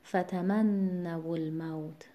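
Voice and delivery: slow whispered recitation, stress before the doubling; whispered stress demo. Shown as a demonstration. Tajweed teacher whispers correct stress in فتمنوا الموت. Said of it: "باز هم ما باید وزن کلمه رو قبل از تشدید بیاریم".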